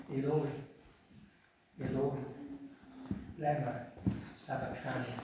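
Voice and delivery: low, hushed, weak cry; deliberately understated, much too quiet. Shown as a demonstration. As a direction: murmur it softly with long pauses. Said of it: "Except he didn't say in a quiet voice. He didn't whisper it".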